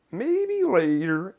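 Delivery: high, slow sing-song with drawn-out words. Retold or imitated. Client putting off a request, imitated sing-song voice. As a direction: chant it high and loud, stretching each word. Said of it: "in a sing-song voice".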